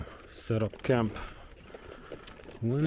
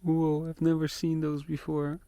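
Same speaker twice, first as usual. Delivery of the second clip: amazed; 4 semitones higher than usual. Real, not acted. First time seeing fireflies.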